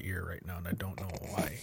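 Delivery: soft unvoiced whisper. Real, not acted. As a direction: whisper it under breath. Trailing off whisper.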